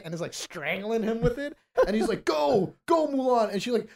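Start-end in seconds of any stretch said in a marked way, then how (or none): 2.2-3.5 raised, urgent voice, high; urgent plea. Retold or imitated. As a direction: shout it for her to run. Retold fight scene, urgent plea.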